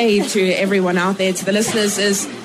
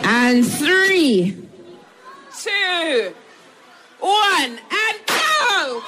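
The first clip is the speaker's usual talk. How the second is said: countdown climbing higher to a shouted go; race countdown; 7 semitones higher, much slower than usual. Real, not acted. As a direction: count them down, rising to the start. Starting a swim race.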